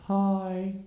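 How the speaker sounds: long monotone zombie greeting; zombified, toneless drone. Acted. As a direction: say it drained of life, dragged out level. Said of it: "turned me into a zombie".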